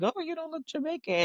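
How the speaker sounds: mock surprise. People reacting to her saying she's Jamaican, mock surprise.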